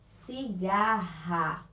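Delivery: "Cigarra" split slowly into ci-ga-rra, RR like h; very slow dictation of "Cigarra", strong h-like R; syllable-by-syllable strong R. Shown as a demonstration. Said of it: "Pronúncia forte".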